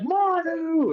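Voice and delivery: high, drawn-out cry of recognition; excited greeting. Retold or imitated. Old friend greets a wrestler after years, imitated excited shout. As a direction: hail a long-lost friend in a high voice.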